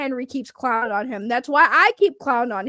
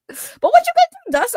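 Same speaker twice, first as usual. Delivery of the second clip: "what you gonna do, Dust?" jumped way higher and louder in a put-on squeak; silly squeaky taunt; 14 semitones higher, 6 dB louder than usual. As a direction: undercut the threat with a high cartoon voice. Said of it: "That sounded awful. That sounded so sus".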